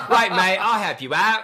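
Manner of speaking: high, laughing mock-British accent; bad British accent. Joking about pottery contestants, in a hammed-up British accent. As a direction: ham up a cheery British accent badly. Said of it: "that's my bad British accent".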